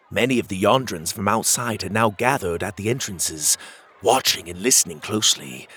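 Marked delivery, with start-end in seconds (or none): none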